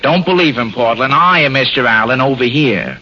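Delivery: lightly impersonated radio comedian; hint of a droning comic host; Fred Allen. Shown as a demonstration. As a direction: insist as if you were the real host. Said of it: "to have an artist impersonate me".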